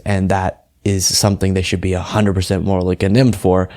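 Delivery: ordinary talk, nothing marked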